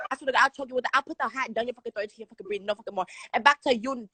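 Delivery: ordinary delivery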